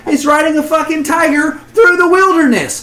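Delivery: loud, high hammed-up tirade; over-the-top fake outrage; mock rant. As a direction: rant like a man who's been wronged. Mock rant after ranch joke.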